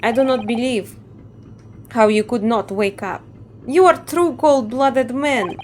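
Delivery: put-on a guard scolding in a light Russian accent. Guard scolds the sleeping prisoner, light Russian accent. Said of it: "I assume he's Russian".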